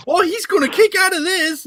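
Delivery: very high-pitched teasing imitation of a hopeful fan; mimicked hopeful excitement. Teasing a friend about watching the finish, high mock excitement. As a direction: squeak it out, full of mock hope.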